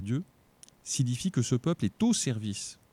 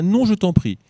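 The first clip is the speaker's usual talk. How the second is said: high, loud urgent plea; crying out; 10 semitones higher, 9 dB louder than usual. A narrator's reading. Jacob begging his brother to accept, loud high plea. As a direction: cry out to stop him refusing. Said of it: "Jacob s'écria".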